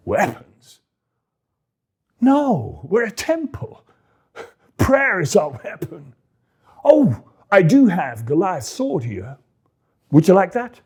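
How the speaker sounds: put-on high, concerned old priest; a fussing worried priest. Ahimelech voiced.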